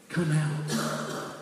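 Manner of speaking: soft, gentle invitation; hushed, loving appeal; tender husband. Acted. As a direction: coax softly like a husband to his wife. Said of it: "like a husband would say to a wife".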